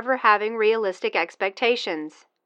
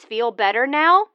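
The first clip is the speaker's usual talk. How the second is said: normal talking voice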